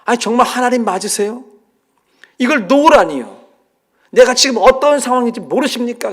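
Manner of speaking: high, loud disbelieving protest; dangling man questioning God's order; incredulous protest. Retold or imitated. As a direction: ask in shocked disbelief. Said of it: "잘못 들은 줄 알았어요".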